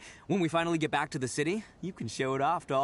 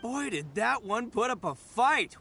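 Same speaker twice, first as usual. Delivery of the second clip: ordinary talk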